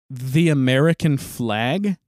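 slow, loud, stretched-out disbelief; retold disbelief, drawn out and rising at the end; incredulous question. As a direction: spell it out slowly as a baffled question. Retold incredulous answer to neighbor.

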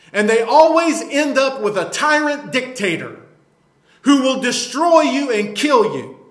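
fired-up pulpit preaching, hard stresses; indignant sermon delivery; forceful preaching. Real, not acted. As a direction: preach it with insistent force, punching key words. Preaches against socialism.

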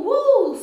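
loud high whoop; excited woo. Real, not acted. Hype shout while dancing.

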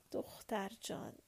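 soft, hushed endearment; tender. A narrator's reading. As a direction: breathe it tenderly into her ear. Husband tenderly holding his wife.

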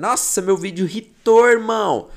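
high, loud mock TikToker excitement; hammed-up brag like a young social media user; TikTok slang speaker. Teacher imitating TikTok users bragging about a hit video, excited. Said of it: "No TikTok a gente fala assim".

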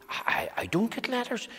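whispered, stammering. Acted. Mister Quiet to himself about the letter, whispered. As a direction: breathe it out unbelieving.

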